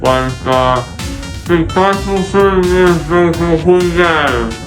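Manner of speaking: muffled, numb mouth; talking through gauze and numbness; groggy from anesthesia. Real, not acted. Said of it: "I was talking extremely muffled in those clips".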